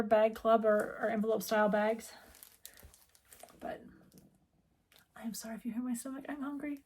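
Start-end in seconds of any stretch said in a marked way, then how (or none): 3.6-6.8 whispered apology. Real, not acted. Apologising for stomach noise.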